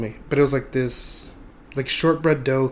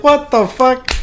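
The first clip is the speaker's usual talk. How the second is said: high, louder shocked outburst; outraged disbelief; 10 semitones higher, 5 dB louder, slower than usual. Retold or imitated. Teen told he's going in the squad car, shocked outburst. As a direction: blurt it in disbelief.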